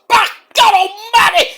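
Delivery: very high, loud, slow cry of "Back God Almighty"; Jim Ross impression. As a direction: scream it like a stunned play-by-play man. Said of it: "Jim Ross!".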